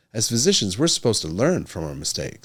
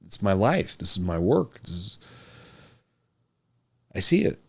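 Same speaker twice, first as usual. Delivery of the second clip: near tears; 2 semitones lower, 4 dB quieter, slower than usual. Real, not acted. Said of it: "if I don't laugh about this, I'm gonna cry".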